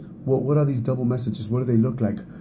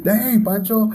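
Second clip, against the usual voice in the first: high, louder hammed-up mock complaint; joking exasperation; 9 semitones higher, 5 dB louder, slower than usual. Husband joking to his wife as he comes home, hammed-up gripe. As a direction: play it as a silly mock gripe. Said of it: "No, I'm kidding".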